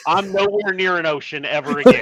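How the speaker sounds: a touch of comic outrage; angry old sailor. Acted. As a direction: vow it like a grumpy shanghaied sailor. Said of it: "He's angry".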